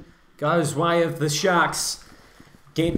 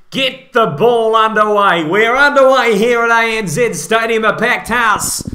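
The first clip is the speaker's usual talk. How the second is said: loud, raised commentator's call; excited kickoff call; 4 semitones higher, 7 dB louder than usual. Real, not acted. Commentator calling kickoff to the listeners, loud and excited. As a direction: announce it big over the crowd.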